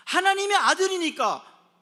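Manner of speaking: loud, high proclamation; shouted refrain. Shown as a demonstration. Preacher proclaiming why they are privileged, shouted with conviction. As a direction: burst out with full conviction.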